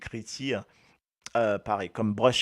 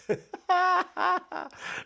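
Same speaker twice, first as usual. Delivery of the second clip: squealing burst of laughter; high laughter; 4 dB louder than usual. Real, not acted. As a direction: laugh helplessly. Laughing fit. (éclat de rire aigu)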